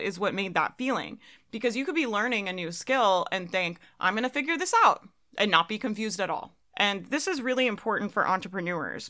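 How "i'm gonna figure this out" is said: upbeat confident. Retold or imitated. Confident inner voice quoted.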